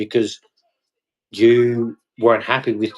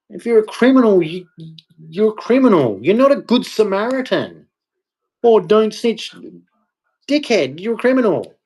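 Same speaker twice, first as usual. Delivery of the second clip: heated rant; 10 semitones higher than usual. Real, not acted. Fed up with criminals' street code, shouting high and angry. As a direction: let the frustration boil over.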